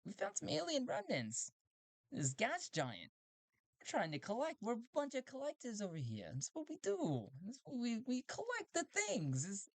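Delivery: very high, squeaky robot voice; a proud robot explaining its purpose; collector robot. Acted. Robot explaining its collecting to Talti, squeaky high voice. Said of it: "is like".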